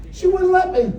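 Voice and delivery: punchline through a laugh. Real, not acted. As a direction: crack up on it.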